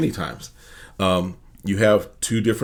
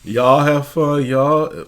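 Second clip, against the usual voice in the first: drawn-out, higher, louder mock speechmaker; attempted nasally voice; 6 semitones higher, 5 dB louder, much slower than usual. Castmate giving her farewell speech, imitated as nasally. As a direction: stretch it out high in a rough nasal imitation. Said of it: "I can't do the nasally voice that she does".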